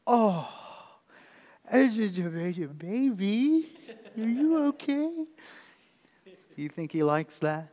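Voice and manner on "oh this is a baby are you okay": put-on high, slow cooing to a big kid; mock baby talk. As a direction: tease him like a baby, drawn out and sing-song. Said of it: "And I'll say".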